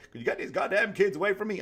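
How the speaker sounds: high, loud furious bark; fed-up father roaring at his family after a long day; angry snap at family. Retold or imitated. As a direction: blow up at the kids like a fed-up dad. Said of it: "I'm paraphrasing now".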